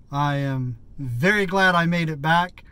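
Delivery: ordinary talk, nothing marked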